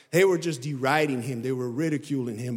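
ordinary delivery

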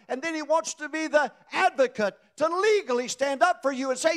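high, loud, ringing sermon climax; full-force preaching. Acted. Preacher lifting the congregation, full-force preaching.

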